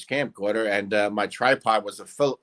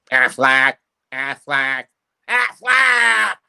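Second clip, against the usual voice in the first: Aflac mascot voice; 6 dB louder, much slower than usual. Shown as a demonstration. Man redoing his Aflac sign-off from the TV show, squawked. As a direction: squawk it out, last one highest.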